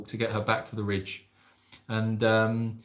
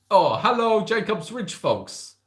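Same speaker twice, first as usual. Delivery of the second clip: higher, drawn-out hello in character; imagined Mims accent; 8 semitones higher, 3 dB louder, slower than usual. Pretend. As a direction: greet the folks in a lightly put-on higher voice. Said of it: "I don't know if that's your accent".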